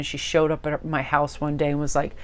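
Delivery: ordinary delivery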